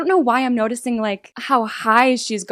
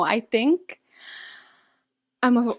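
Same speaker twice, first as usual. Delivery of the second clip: ordinary delivery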